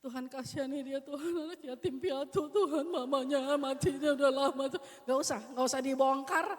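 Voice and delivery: loud, hammed-up rambling prayer, partly laughing; mock a prayer that drags on and on. Shown as a demonstration. Teacher mocks a long-winded prayer over a child, loud and hammed-up. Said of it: "jangan yang bertele-tele".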